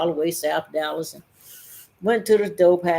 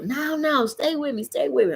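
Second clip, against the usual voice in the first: higher, begging voice; pleading friend; 9 semitones higher than usual. Retold or imitated. High friend begging her to stay.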